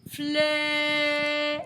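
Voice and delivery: "flè" sung out high and loud, held long; held syllable flè. Shown as a demonstration. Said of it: "on entend le E".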